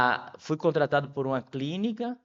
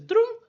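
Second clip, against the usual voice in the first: a sudden high, loud clunk, like a coin dropping; playful mouth sound for the penny dropping; clunk sound effect; 6 dB louder than usual. Retold or imitated. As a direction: toss out a quick imitated clunk. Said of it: "a ficha faz assim".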